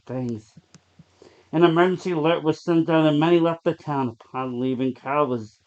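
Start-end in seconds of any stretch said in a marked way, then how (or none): none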